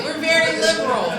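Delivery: ordinary talk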